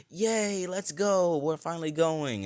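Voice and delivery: very high, loud delighted cheer; cheering child. Retold or imitated. Little girl cheering as the adventure starts, excited. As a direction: squeal it like a thrilled kid.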